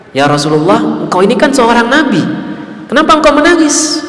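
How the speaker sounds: puzzled companion asking. Retold or imitated. Preacher voices a companion asking the Prophet why he weeps, puzzled. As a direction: ask it in wonder, pitch climbing.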